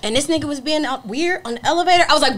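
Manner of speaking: ordinary delivery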